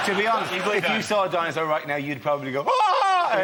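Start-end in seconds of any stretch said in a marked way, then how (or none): 2.7-3.4 mock high-pitched shriek; high-pitched scream. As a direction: shriek comically high, as if startled. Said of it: "in a high-pitched voice".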